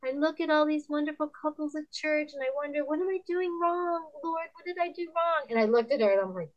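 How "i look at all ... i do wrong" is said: high, plaintive imitation of a struggling wife; put-on woeful voice, pitched up; troubled churchgoer. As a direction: mimic a discouraged woman confiding. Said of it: "And she's like".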